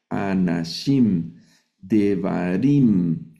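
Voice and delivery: "anashim, devarim" very slow, each ending on a long stressed -im; final -im sound. Shown as a demonstration. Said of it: "ese sonido im que está al final". ("anashim, devarim" muy despacio, alargando el im final)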